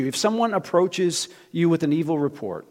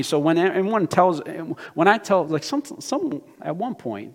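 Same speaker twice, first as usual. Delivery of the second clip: ordinary talk, nothing marked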